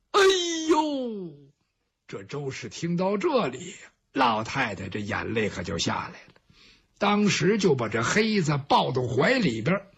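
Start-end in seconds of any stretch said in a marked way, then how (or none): none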